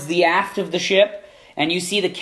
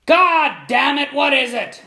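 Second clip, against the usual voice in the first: strong captain voice; 7 semitones higher, slower than usual. Acted. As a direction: snap back as the annoyed ship's captain. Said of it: "a very deep and strong voice".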